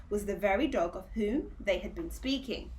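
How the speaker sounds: usual speaking voice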